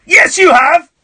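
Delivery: loud, high-pitched rebuttal; exasperated accusation; indignant contradiction. Real, not acted. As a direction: flatly refuse to believe the caller. Host contradicts the caller.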